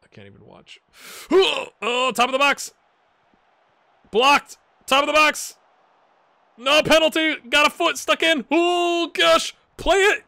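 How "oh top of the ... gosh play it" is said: high, loud frantic commentary; excited gasp and yelling. Real, not acted. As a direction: shout at the chaos in the box. Tense goalmouth scramble live.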